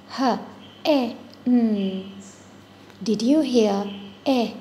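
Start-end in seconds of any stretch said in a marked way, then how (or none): 0.1-2.1 H-E-N broken into separate sounds, huh, eh, nnn; slow, sounded out. Shown as a demonstration. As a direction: sound out H-E-N slowly, pausing between each sound. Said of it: "Let me say it slowly".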